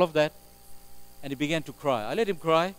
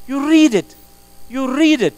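emphatic exhortation; 9 semitones higher, 10 dB louder than usual. Real, not acted. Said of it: "emphatic repeated exhortation".

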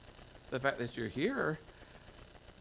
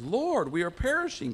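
frightened cry; 7 semitones higher, 4 dB louder than usual. Acted. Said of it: "cried the disciples".

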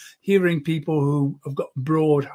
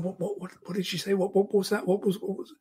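quick, hushed, higher-pitched muttering; softer, higher, flustered repeated questions; confused listener; 5 semitones higher, 5 dB quieter than usual. Acted. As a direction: imitate baffled listeners asking each other what was said. Voices confused listeners unable to follow.

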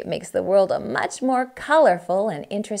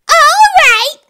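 high, loud proclamation; haughty bully announcing an exit; self-important shout; 12 dB louder, slower than usual. Acted. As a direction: declare it grandly at full voice. Smug bully announces her exit.

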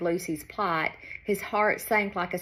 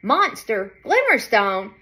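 excited shouting; 3 semitones higher, 7 dB louder than usual. Acted. Animals spread excited gossip.

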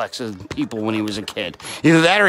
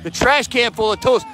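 excited commentary; 6 semitones higher than usual. Real, not acted. Wrestler passing with trash can.

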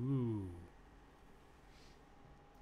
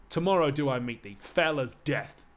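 louder, slow menacing vow; a warrior promising death in battle; grim threat; 3 semitones higher, 9 dB louder than usual. Acted. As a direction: spit the vow out slowly. Hector's threat.

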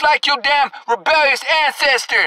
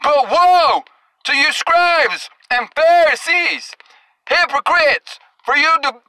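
raised street preacher denouncing; fiery rebuke to the hypocrites; preaching at full force; 4 semitones higher than usual. Acted. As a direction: call them out, verse by verse.

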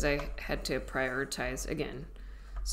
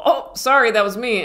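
mock apology; 6 semitones higher, 11 dB louder than usual. Retold apology at belly sound.

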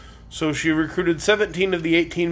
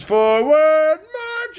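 high, loud drill yell; yelled order; 10 semitones higher, 5 dB louder, much slower than usual. Acted. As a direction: yell it down the line. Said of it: "majors yelled to the captains".

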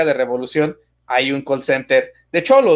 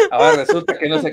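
laughing; 8 semitones higher than usual. Real, not acted. Laughing at the confession.

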